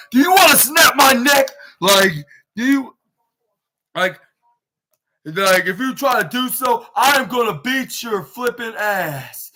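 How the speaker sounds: hammed-up enraged wrestler voice; furious threat. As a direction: act out a wrestler's angry threat. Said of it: "flub and pissed off".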